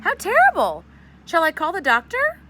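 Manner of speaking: high, loud alarmed cry; distraught. Acted. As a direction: panic at the news. Distraught mother hears bad news.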